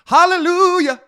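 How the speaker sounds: loud, high hallelujah; joyful shout. Real, not acted. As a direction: shout it in triumph. Celebrating Elon buying Twitter.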